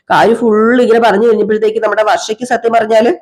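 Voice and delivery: usual speaking voice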